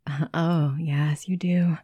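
normal talking voice

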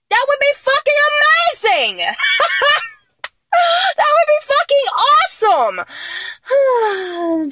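very high, giddy outburst; excited squeal. Acted. Fan imagining posing as her idol, giddy squeal.

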